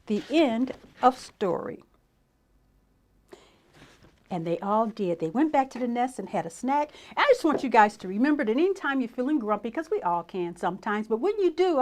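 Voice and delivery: ordinary delivery, nothing marked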